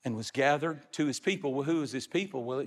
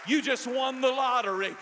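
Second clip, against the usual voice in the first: preaching at full force; 7 semitones higher than usual. Acted. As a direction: carry the church toward heaven in full preaching voice. Preacher voicing dying Jacob.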